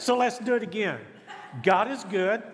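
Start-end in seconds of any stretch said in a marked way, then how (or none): 1.6-2.4 loud, higher call with a lifted ending; call and response. Shown as a demonstration. Preacher prompting the congregation's response, calling out. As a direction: call it out for the crowd to answer.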